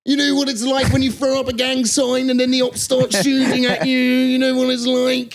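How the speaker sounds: hammed-up impression of a TV presenter; Michael McIntyre impression. Mocking the documentary host's presenter voice. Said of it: "I've got a good McIntyre".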